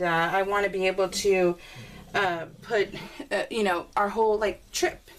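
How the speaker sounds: usual speaking voice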